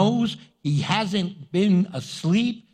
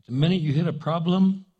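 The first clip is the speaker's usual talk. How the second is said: ordinary delivery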